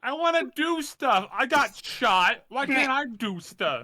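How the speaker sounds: high, loud whining complaint; whiny. Real, not acted.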